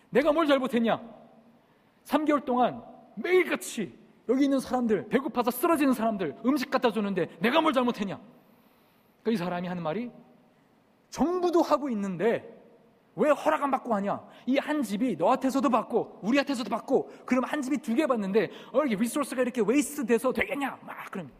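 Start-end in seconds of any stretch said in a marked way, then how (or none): 0.1-8.2 high, loud indignant retort, reenacted; loud, defiant protest; shouting back. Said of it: "그래서 저도 막 소리 질렀습니다". 11.1-20.8 high, loud scolding from an official; raised-voice, accusing rebuke; shouted reprimand. Retold or imitated. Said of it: "저희를 보고 막 소리를 지릅니다".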